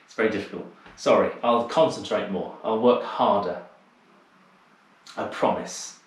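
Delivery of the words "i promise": whispered, playful promise; mock whisper. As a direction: breathe it like a guilty little secret. Jokey apology after losing track.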